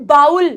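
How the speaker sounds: "bowl" said as "baa-ul", rhyming with owl; wrong pronunciation. Shown as a demonstration. Teacher ruling out the mistake, "ow" sound in "bowl". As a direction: stretch "bowl" into an "ow" glide. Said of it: "this word is not".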